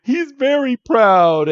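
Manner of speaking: put-on very high, slow little girl voice on the verge of tears; hammed-up upset little girl defending her brother; tearful little girl. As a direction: plead it in a tiny high voice. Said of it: "And she starts to cry".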